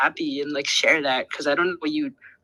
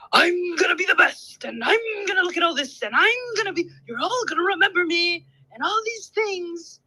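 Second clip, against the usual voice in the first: mock boasting; 12 semitones higher than usual. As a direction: parody someone bragging about future glory. Mimics boastful talk about legacy.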